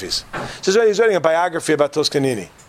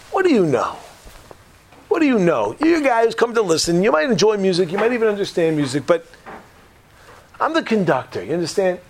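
indignant expert; 6 semitones higher than usual. Acted. Acted maestro sets listener straight.

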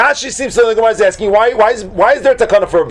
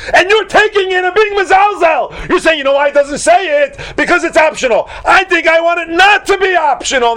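scolding; 11 semitones higher than usual. Acted. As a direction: tell off a student who cheapens the law. Teacher scolding imagined careless student.

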